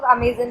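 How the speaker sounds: अमेज़न opening on "a", as "uh-MAY-zun"; pronunciation variant. Shown as a demonstration. Teacher showing another variant, अमेज़न as "a-may-zun". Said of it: "कुछ लोग अमेज़न कहते हैं".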